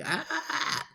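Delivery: high, strained little throat rasps; imitating a raw throat; scratchy throat. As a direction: mimic a sore throat catching. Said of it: "my throat was like".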